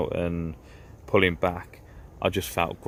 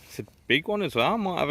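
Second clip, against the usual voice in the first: excited; 8 semitones higher than usual. Real, not acted. Spots a tench on the line.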